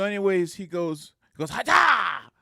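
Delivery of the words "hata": very loud, high sudden kiai; martial arts yell. Retold or imitated. Guard swinging nunchucks at him, imitated martial arts yell. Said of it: "Like that".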